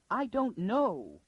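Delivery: a high, slow wail. Acted. Lady Kalia answering Arcadia, a high slow wail. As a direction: let it break into a drawn-out wail. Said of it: "wailed the other".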